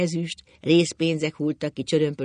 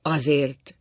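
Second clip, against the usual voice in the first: weary insistence; tired repetition; 5 dB louder than usual. A narrator's reading. Husband worn out by the argument, tired. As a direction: repeat it wearily. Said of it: "ismételte fáradtan".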